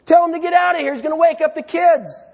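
a cross wife ordering him; annoyed wife. Acted. Wife demanding the husband send the friend away, loudly. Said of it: "wife scolding at midnight".

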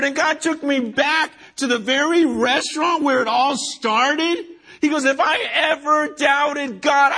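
emotional outburst. Retold or imitated. The pastor voices James back in the restaurant, shouting with tearful joy. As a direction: cry it out, overwhelmed with gratitude.

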